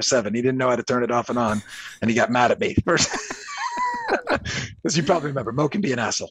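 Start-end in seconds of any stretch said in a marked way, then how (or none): 3.1-4.8 high, bursting laugh; laughing hard at the memory; big laugh. Real, not acted. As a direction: let a real laugh break out. Laughing at his own story.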